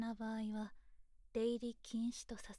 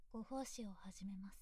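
ordinary talk, nothing marked

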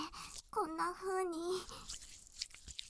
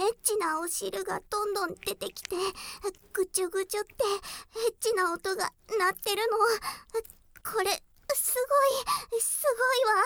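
louder, rising, gasping; breathless excitement; 3 semitones higher, 5 dB louder than usual. Acted. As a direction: build the excitement. Rising arousal.

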